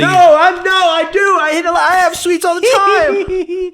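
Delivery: high squeaky denial; squeaky protest. Acted. Squeaky protest about sweets.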